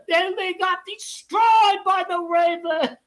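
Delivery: high, loud and slow; mocking laughing shout. Real, not acted. Streamer mocking the Cowboys' loss to the Ravens, gleeful. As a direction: crow it out gleefully.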